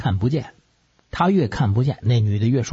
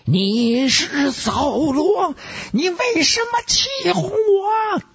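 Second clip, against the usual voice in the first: shrill, drawn-out accusing shriek; screeching medium confronting a deceiver; high-pitched cry; much slower than usual. Acted. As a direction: wail it out slowly, very high. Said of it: "瞪着双眼高声叫道".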